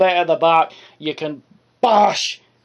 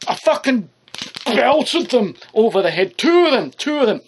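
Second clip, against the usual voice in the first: worked-up boast; 5 semitones higher than usual. Real, not acted. Excitedly recounting hitting kids.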